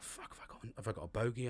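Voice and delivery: half-whispered worried thought to himself; mock-nervous whisper to yourself; hushed inner panic. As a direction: murmur it like a private panic. Panicked thought retold at dinner.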